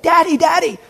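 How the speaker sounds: put-on high-pitched child calling after dad; kid calling out. Little boy left behind calls his dad, high kid voice. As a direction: call to a dad who is far ahead. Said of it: "he realized, Oh, no, Daddy's way up there".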